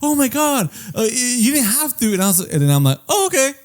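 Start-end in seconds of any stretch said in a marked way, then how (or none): 0.0-2.1 much higher, gushing fake astonishment; mock surprise. Friend acting amazed at repaid cash, mock high surprise. 3.1-3.5 squeaky high little "oh, okay"; a tiny caught-off-guard yelp of thanks; mock surprise. Taking back the money, mock high surprise. Feigned reaction to repayment.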